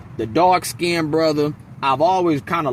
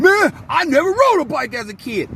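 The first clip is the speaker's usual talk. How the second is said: grown man admitting it; 10 semitones higher than usual. Retold or imitated. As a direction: blurt it out like a grown man ashamed. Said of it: "So as a grown person, you're gonna be".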